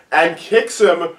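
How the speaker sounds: ordinary talk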